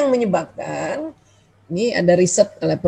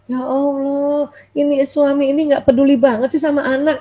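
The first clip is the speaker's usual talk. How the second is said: resentful inner complaint; 4 semitones higher than usual. Acted. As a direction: play an exasperated wife muttering her suspicion. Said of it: "Mulai masuk nanti prasangka berikutnya".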